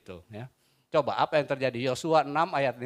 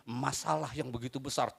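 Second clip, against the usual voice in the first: ordinary talk, nothing marked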